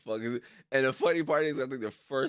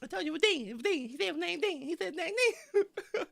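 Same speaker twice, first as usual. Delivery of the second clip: high mocking retell.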